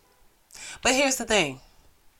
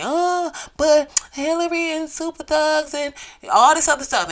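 high, put-on whiny mimic of complainers; mock the complainers in a whiny voice; mocking the 2016 complainers in a whiny voice; 9 semitones higher, 5 dB louder than usual.

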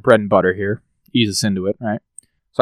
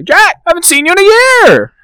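exaggerated surprised greeting; 7 dB louder than usual. Mock greeting after absence.

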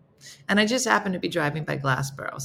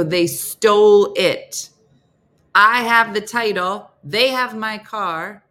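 loud and slow, each word hit; slow insistence; 4 semitones higher, 8 dB louder, slower than usual. Retold or imitated. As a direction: spells it out to the confused rep, loud and slow.

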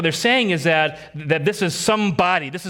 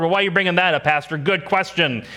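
ordinary talk, nothing marked

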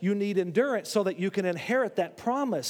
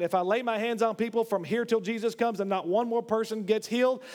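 ordinary delivery

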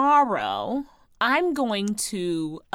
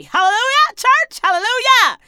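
hallelujah shout; 12 semitones higher, 10 dB louder than usual. Pretend. Mock church shout.

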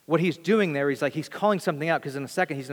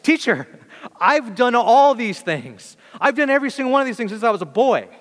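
high, loud, overjoyed boast; excited; 9 semitones higher, 7 dB louder than usual. Acted. Rich young ruler telling Jesus he kept every commandment, excited. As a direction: burst out eagerly, stretching it, then racing on. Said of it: "he's probably excited".

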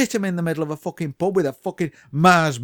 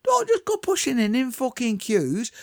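ordinary delivery

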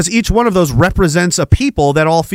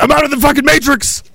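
ordinary delivery

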